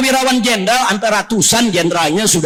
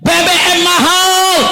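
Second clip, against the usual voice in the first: high, stretched-out public outcry; slow high-pitched protest call; protest cry; 8 semitones higher, slower than usual. Retold or imitated. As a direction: imitate the people yelling their complaint. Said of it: "Rakyat teriak".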